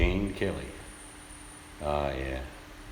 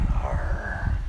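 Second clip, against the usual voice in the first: 1 pirate arr; 6 semitones lower than usual. Pretend. Pirate noise.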